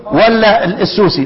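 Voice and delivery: normal talking voice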